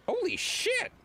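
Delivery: a sharp high yelp of surprise; surprised. Real, not acted. Surprised at old name.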